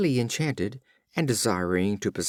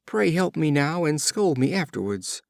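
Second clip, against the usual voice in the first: cried plea; 6 semitones higher than usual. A narrator's reading. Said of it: "cried the youth".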